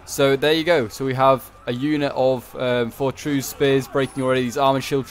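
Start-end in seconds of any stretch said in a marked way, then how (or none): none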